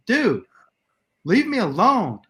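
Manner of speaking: high, loud fed-up protest; retold exasperated yell. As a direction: act out snapping at a pest. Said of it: "after I yelled at him".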